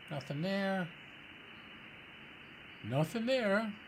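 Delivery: ordinary delivery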